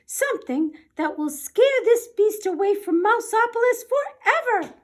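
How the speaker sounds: high squeaky professor; professor mouse. Acted. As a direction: address the room like a tiny scholar. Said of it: "said Professor O'Hare".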